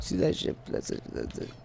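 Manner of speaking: breathy whisper, barely audible; hushed whisper. Real, not acted. Gamer watching something creepy, hushed whisper. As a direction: keep it under your breath.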